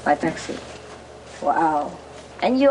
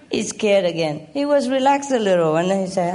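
normal talking voice

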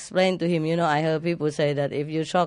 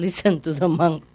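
ordinary delivery

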